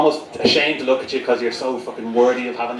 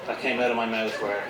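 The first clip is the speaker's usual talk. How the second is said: normal talking voice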